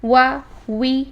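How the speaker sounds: وا، وی slow and drawn out, lips rounded; وا and وی said slowly with a clear w; drill demo. Shown as a demonstration. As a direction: model each syllable slowly for students to repeat. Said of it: "واو کو پہلے حرکات ثلاثہ کے ساتھ دیکھتے ہیں".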